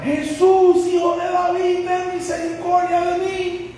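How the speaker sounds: high, sustained cry for mercy; grito suplicante. Acted. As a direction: call out at the top of your range, stretching the words. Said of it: "Y él comenzó a gritar". (grito agudo y sostenido de súplica)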